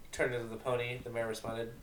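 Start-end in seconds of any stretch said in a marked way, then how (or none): none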